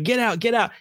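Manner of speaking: high mock yell at normal volume; retold scream. Coach's team yelling at the ball, mock scream. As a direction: urge the ball out in an imitated scream. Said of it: "and we're screaming".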